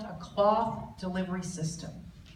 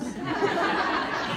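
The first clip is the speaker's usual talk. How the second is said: breathy laugh; 6 semitones higher than usual. Real, not acted.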